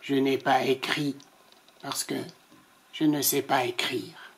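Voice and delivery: low, soft shamed reply; softly. Acted. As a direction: admit it gently, nearly under breath. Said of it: "répondit doucement".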